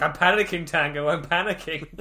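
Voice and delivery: loud, laughing fake panic; mock panic. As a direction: yelp it out half-laughing. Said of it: "and he's like".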